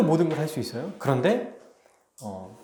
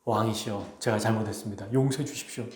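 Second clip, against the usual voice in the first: lower, softer begging for mercy; trembling plea; 4 semitones lower, 6 dB quieter than usual. Acted. Noble bowing before the revealed king, trembling plea. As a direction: grovel before the king, voice shrinking. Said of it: "엎드려서 벌벌 떠는 거예요".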